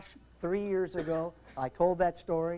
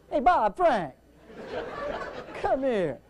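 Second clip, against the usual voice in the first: calling out; 4 semitones higher, slower than usual. Retold or imitated. Tower controller to his colleagues, calling out. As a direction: call colleagues over to look.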